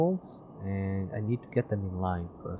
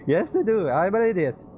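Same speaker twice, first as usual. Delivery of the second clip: high, loud cheerful reply; playful; 11 semitones higher, 9 dB louder than usual. Acted. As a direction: answer brightly, self-mocking. Reads player reply with laugh.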